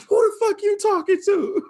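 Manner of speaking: high, loud offended mother; high loud offended mom voice; angry mom. Retold or imitated. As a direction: snap back like a mom who won't take it.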